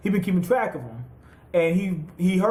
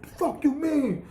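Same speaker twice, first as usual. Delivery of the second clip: mock outrage; 8 semitones higher than usual. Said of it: "beside him".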